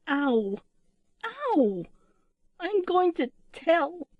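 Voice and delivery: high, drawn-out wail of complaint; tearful little owl. A narrator's reading. Littlest owl threatening to tell, tearful whine. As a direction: wail it like a hurt child. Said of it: "Then she began to cry".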